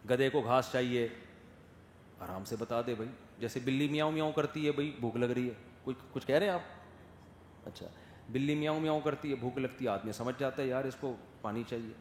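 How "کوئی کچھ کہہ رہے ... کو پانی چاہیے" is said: ordinary delivery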